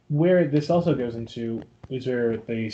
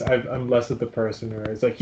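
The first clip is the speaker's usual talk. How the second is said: ordinary talk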